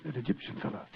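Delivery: whispered alley talk, very soft; hushed. Acted. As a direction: keep it low so the stalker won't hear. Hiding in a dark alley.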